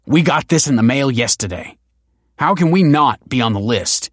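protesting. A narrator's reading. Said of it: "protested the man".

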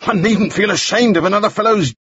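high-pitched, insistent crying out; very loud. A narrator's reading. Balfour argues against Moody's privacy, being very loud. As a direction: push the pitch right up, carrying to the room. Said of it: "He was being very loud".

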